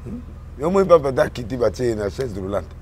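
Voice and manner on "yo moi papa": ordinary delivery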